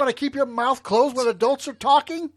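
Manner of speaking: loud, high mock scolding. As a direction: yell at the kid like a fed-up adult.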